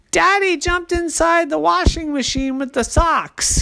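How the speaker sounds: put-on high-pitched child exclaiming; excited little girl. A narrator's reading.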